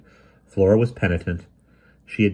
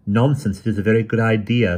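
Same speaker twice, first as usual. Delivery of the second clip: stern and louder; firm, no-nonsense rebuke; austere; 4 semitones higher, 6 dB louder than usual. A narrator's reading. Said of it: "said Flora austerely".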